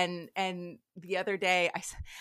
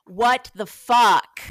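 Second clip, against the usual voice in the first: slow, much louder what the fuck; slow emphatic; 11 dB louder, much slower than usual. Retold or imitated. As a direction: spell it out loudly, deliberately. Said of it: "he's like".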